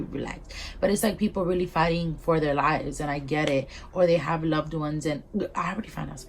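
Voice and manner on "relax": "relax." said much lower and softer, sinking into calm; drawn-out relax. Retold or imitated. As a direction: sink down into a low, soft relax. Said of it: "Re-, re-, re".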